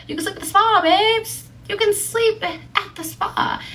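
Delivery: very high, sing-out plea; playful coaxing. Retold or imitated. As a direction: coax in a put-on high voice. Repeats retort as playful coaxing.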